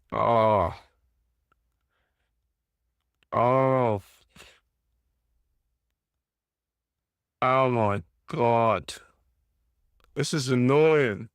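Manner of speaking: low, slow, fed-up moans; deflated groaning. Real, not acted. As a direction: groan it like the match is lost. Fan sulking after conceded goal.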